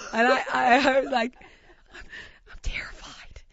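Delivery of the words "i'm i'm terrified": hushed, urgent whisper; a frantic secret whisper to your wife; panicked whisper. Retold or imitated. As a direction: hiss it so the chef won't hear. Frightened aside to wife at bar.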